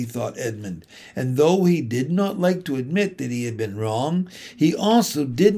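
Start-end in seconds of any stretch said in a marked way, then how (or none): none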